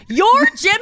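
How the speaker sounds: high, loud startled question; shocked question. Retold or imitated. Elaine realizes the man is Jimmy, loud and startled. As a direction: gasp it out in disbelief. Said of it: "And she's like".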